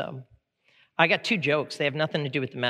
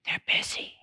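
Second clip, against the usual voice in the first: put-on hushed whisper of a small kid; secretive little whisper. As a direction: whisper it like a child in hiding. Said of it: "Why are you whispering".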